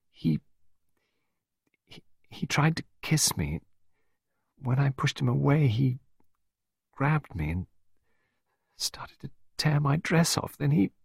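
high, halting, broken phrases; distressed confession. A narrator's reading. Pippa telling Clarissa what Oliver did, halting. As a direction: struggle to get each piece out.

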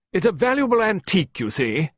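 high, ringing explanation; hearty countrywoman. A narrator's reading. Visitor explaining the antique desk, hearty. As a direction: explain it in a big carrying voice.

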